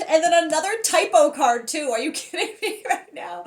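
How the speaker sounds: high, laughing incredulity; giggly, exasperated exclaiming; amused disbelief. Real, not acted. Amused disbelief at another typo.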